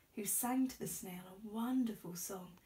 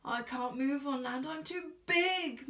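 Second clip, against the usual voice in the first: moaning whale; 3 semitones higher, 7 dB louder than usual. A narrator's reading. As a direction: groan in helpless despair. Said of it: "moaned the whale".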